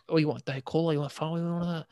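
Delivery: mock thick Scottish accent, run together. Service-station worker offering drinks to mum, in a mock thick Scottish accent. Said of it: "it was very thick".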